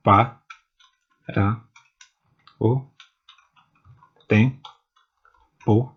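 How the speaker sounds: slow, each syllable set apart with long pauses. Shown as a demonstration. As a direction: spell out the verse one syllable at a time. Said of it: "separação silábica gramatical e". (sílaba por sílaba, devagar)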